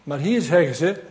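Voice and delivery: usual speaking voice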